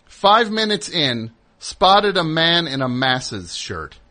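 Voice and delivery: announcing a shocking text to the co-host, slow and loud; dramatic text reading. Retold or imitated. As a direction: read it out like breaking news, slowing down. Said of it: "This is what Pat texted".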